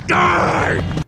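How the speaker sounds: high, breathy scream of a movie slasher; hammed-up slasher cry; mock killer shout. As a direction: yell it like a horror villain. Imitates killer from other movie.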